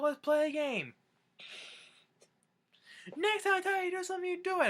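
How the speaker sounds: high, mock-furious yell; angry scolding. Grandpa telling Michael to do as told, mock-angry yell. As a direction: imitate an angry tirade in a strained high voice.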